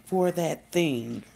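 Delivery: slow, hesitant mock mom; slow euphemism. Mom avoiding the word herpes, slow and hesitant. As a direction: drag out the euphemism warily. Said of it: "She was like".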